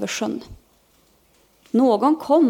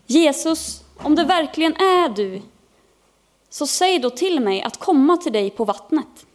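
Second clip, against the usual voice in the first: high, earnest call across the water; 6 semitones higher than usual. A narrator's reading. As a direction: call up and out to someone far off. Peter calls to Jesus across water. (ropande vädjan med högre röst)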